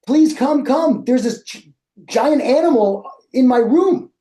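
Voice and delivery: high, urgent pleading shout; panicked yell. Retold or imitated. Calling host mom to see the giant animal, panicked high pleading. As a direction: beg her to come, pitch way up. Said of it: "I'm yelling at my host mom".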